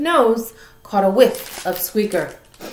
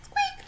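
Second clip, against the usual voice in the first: very high, much softer critter squeak; a faint little animal squeak; tiny squeak; 10 dB quieter than usual. Acted. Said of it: "And he said".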